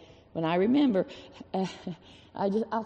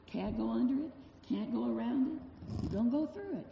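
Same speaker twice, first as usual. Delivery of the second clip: hushed refrain; 2 semitones higher, 9 dB quieter than usual. Acted. Preacher echoing a children's bear hunt refrain, hushed. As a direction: let the refrain sink to a hush.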